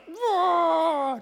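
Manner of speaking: a big high cry of pain, retold; a kid's howl as the broken arm is set; pained yelp. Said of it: "And I'm like".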